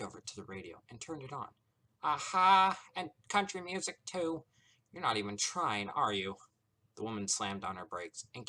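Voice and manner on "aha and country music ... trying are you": smug mockery. Discord mocking.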